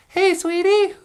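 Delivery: put-on sweet falsetto Natasha calling her puppet boyfriend. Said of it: "you'd hear Natasha go".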